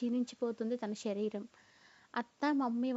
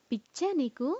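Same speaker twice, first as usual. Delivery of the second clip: a touch of stern disbelief, a bit louder; serious scolding; 4 dB louder than usual. A narrator's reading. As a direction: scold her friend, lightly but firmly. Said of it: "serious అయింది".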